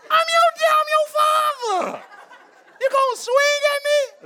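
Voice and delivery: high, loud hammed-up outrage, half laughing; mock indignant dad. Dad mock-scolding his daughter who swung at him, hammed-up. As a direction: play the offended father for laughs.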